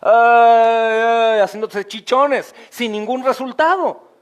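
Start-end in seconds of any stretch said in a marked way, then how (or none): 0.0-1.4 loud mock lament, "Ay, ay"; mock wailing. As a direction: imitate a loud wail at the Wailing Wall. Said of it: "los golpes que se daban... los religiosos judíos".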